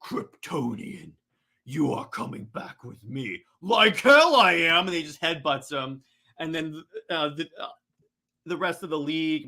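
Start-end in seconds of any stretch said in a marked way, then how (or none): 0.0-3.4 low, soft, slow villain voice; menacing supervillain, deep and deliberate; Darkseid. Acted. Villain claims his captive Kryptonian, low slow menace. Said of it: "Darkseid goes". 3.6-4.9 loud, higher, drawn-out defiance; defiant retort. Acted. Hero refuses to go with villain, loud defiance.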